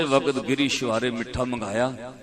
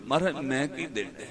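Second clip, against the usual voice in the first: softly voiced helpless plea; subdued, understated retold weeping; retold tearful confession; 7 dB quieter than usual. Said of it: "ਰੋ ਪਿਆ".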